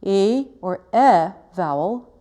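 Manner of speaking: slow bright "e" held open; sustained e vowel. Shown as a demonstration. Teacher modelling the e before the schwa. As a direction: hold the "e" vowel long and clear. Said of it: "articulating a good".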